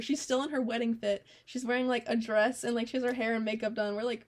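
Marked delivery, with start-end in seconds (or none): none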